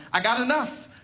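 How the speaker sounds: ordinary delivery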